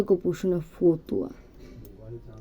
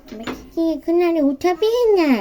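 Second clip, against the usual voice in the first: put-on high, loud whiny child voice; child god voice; 7 semitones higher, 8 dB louder than usual. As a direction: plead like a little child. Gopal voiced after the bath.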